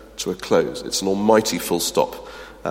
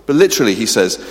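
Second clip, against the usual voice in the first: usual speaking voice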